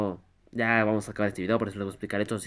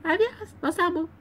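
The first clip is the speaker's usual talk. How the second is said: adiós squeaked very high; squeaky high voice. Pretend. Youtuber signing off to fans in a joke squeak. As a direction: say adiós in a tiny cartoon squeak.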